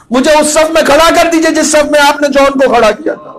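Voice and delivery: high, loud impassioned address to the Imam; full-force preaching. Real, not acted. Preacher speaking to his master Hussain, impassioned and loud.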